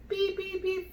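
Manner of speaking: high, even monitor beeps; high-pitched machine imitation; imitated monitor beep. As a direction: beep like a heart-rate monitor. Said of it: "I'm hearing beeping".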